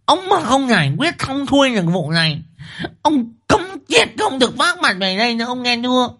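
high-pitched, relentless wifely ultimatum; fierce scolding wife. A narrator's reading. Wife orders husband to find the runaway, fierce scolding. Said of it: "Thị Liên trừng mắt chỉ tay vào mặt chồng".

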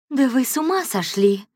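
high, loud indignant cry; indignant outburst. A narrator's reading. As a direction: flare up at the intruder. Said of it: "возмутилась Мария Фергин". (возмущённо, громко и высоко)

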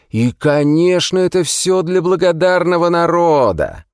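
rising, high sarcastic mockery; sarcastic prisoner. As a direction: build the mockery up. (нарастающий сарказм узника)

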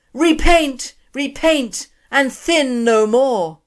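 slow, loud, high divine pronouncement; booming voice from sky. Acted. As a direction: boom it down from the heavens, slowly. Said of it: "a mighty voice spoke".

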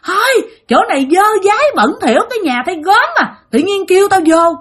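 high-pitched disgusted scolding; relentless cursing. Acted. Possessed mother cursing the dirty house, high angry rant. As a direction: complain bitterly in a high, agitated voice. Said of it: "mẹ cứ liên tục chửi rủa".

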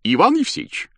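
doctor calling. A narrator's reading. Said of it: "обратился к нему доктор".

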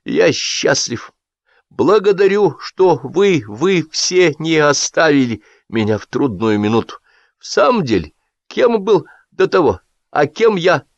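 put-on high, emotional drunken speech; a burned-tongue host gushing thanks; tipsy host's toast. A narrator's reading. Drunk host thanking his guests, emotional.

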